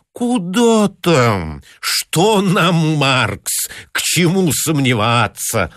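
slow, high, mocking drawl; sarcastic pedant. A narrator's reading. Irritable pedant mocking the young clerk, slow and high. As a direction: pour out sarcastic scorn, eyes rolled to the ceiling.

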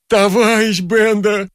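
high, drawn-out wail of a plea; desperate pleading. A narrator's reading. Vorobyaninov begging as Bender threatens to throw him out, desperate pleading. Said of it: "взмолился Воробьянинов".

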